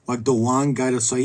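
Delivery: ordinary talk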